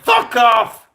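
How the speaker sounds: high, loud, explosive dismissal; re-enacted yell to send them running; angry shout. Gunman chasing off the crew, angry shout. Retold yelling at crew to leave.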